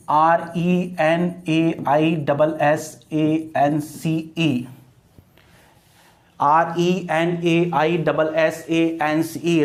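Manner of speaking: measured classroom spelling; spelled out slowly. Shown as a demonstration. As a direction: dictate it one letter at a time. Spelling out word for students.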